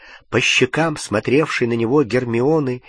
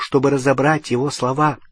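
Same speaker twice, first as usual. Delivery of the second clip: plain reading voice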